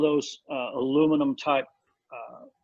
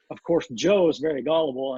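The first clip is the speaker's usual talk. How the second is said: usual speaking voice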